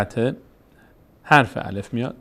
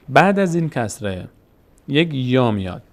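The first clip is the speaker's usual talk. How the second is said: ordinary talk, nothing marked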